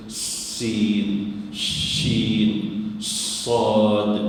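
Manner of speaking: "sin, shin, sad" each with a long opening hiss; air released first. Shown as a demonstration. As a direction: sustain the consonant before the vowel in sin, shin, sad. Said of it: "Angin itu dijelaskan keluar dulu".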